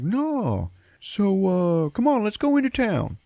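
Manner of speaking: high, loud goofy dad voice; oblivious dad. Retold or imitated. Dad brushes off scared wife, high goofy voice. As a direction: brush it off in a hammed-up cheery voice.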